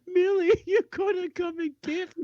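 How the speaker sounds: high, whiny mock plea for a pickup; mock pitiful phone call. Friend mocking Ryan's call to his wife, high and whiny. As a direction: whimper it like a hurt friend begging to be fetched. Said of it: "he calls up and says".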